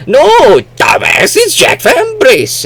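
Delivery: loud, high, hammed-up correction; mock exasperated shout. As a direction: shout down a slow listener in play. Acted quarrel over a name.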